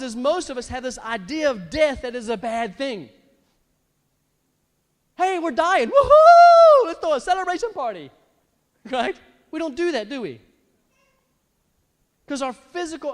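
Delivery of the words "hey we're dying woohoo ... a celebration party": whooping party cheer, high and loud; gleeful hammed-up announcement; mock celebration. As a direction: celebrate dying with a big whoop. Acts out celebrating a death.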